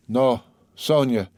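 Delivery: ordinary delivery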